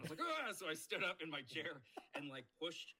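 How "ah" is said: very high, loud mock yelp; imitated startled scream; panicked yelp. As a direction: let out a squealed "ah" of panic. Acts out panic before standing.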